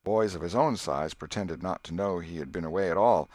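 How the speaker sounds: usual speaking voice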